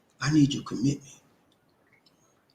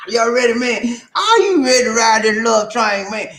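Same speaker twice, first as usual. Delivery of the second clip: hype call; 8 semitones higher than usual. Acted. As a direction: pump up the crowd. Hype host calling crowd.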